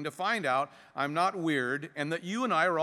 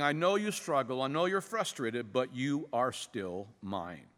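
softer, slowing to a low, tender close; warm embrace; 4 semitones lower, 3 dB quieter than usual. Acted. Heavenly Father reassuring a struggling child, warm and tender. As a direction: reassure them like a loving father, easing down. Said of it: "It's like a warm embrace from your Heavenly Father".

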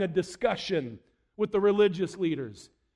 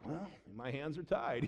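soft, low, helpless give-in; mock resignation; 6 semitones lower, 12 dB quieter than usual. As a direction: pretend to give up, dropping the voice low. Mocking God as helplessly obliged.

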